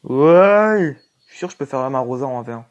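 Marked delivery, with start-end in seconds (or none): 0.0-1.0 loud high cheer; excited shout. Real, not acted. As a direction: yell it in triumph. Big game cheer.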